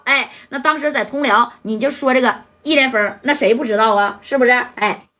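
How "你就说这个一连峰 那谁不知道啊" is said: usual speaking voice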